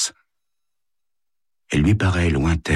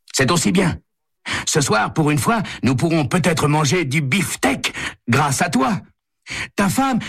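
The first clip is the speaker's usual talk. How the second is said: much higher-pitched character voice; phone caller; 13 semitones higher than usual. A narrator's reading. Caller chats to Santo about tonight's meal, high-pitched. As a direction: play the caller high above the narration.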